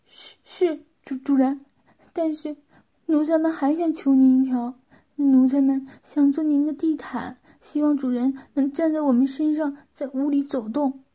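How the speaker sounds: timid servile pleading. A narrator's reading. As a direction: stumble at first, then beg humbly.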